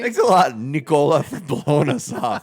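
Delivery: hammed-up indignant rant; mock telling-off. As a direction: blame them loudly, playing it up. Mock telling off absent guest Nicola.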